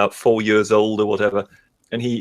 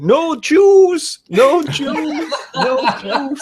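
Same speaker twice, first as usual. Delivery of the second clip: put-on high, loud child's wail fading to a chant; a toddler whining for juice at the dad, much higher; 3 dB louder, much slower than usual. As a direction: imitate a small kid whining for juice.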